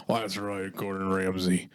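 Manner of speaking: lightly voiced Dave Ramsey announcing the job swap. To Gordon Ramsay, lightly voiced radio host. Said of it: "you gotta be thinking".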